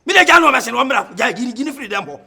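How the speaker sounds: high and loud, hammering every phrase; shouting rant. Acted. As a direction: rant at full volume in a heated quarrel. Heated rant in quarrel.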